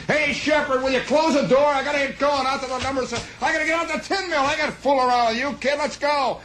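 put-on high, fast, brash young driver voice; cocky truck driver. Truck driver hurrying the new kid along, high fast brash voice.

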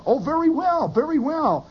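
high, singing-out mock assurance; bluffing assurance. Fake doctor answering the admiring nurse, bluffing assurance.

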